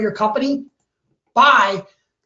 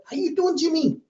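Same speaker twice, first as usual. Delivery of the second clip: higher, light imitation of a Mexican accent; 5 semitones higher, 4 dB quieter than usual. Restaurant owner greeting Jimmy by name, in a Mexican accent. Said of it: "he's got the Mexican accent".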